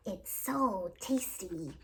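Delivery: slow, chewing through a mouthful; mouthful of gingerbread. Acted. Gretel chewing gingerbread while answering the witch, mouth full. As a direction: talk as if your mouth is stuffed with cake. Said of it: "said through a mouthful of gingerbread".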